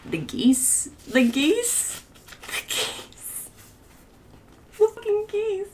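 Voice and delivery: climbing, high-pitched squeal that gives out and returns; overwhelmed. Real, not acted. Reader reacting to the geese, emotionally overwhelmed.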